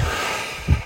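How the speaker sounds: long, breathy sigh out; soft releasing sigh; calming exhale. Shown as a demonstration. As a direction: let a slow breath go to settle yourself. Acts out calming breath in compulsion.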